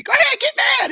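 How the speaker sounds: very high-pitched goading cry; egging on. Acted. Emotions daring mad to act, high goading voice. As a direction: squeal it as an excited dare. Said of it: "and emotions be".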